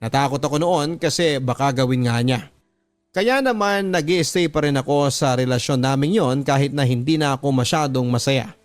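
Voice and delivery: ordinary talk, nothing marked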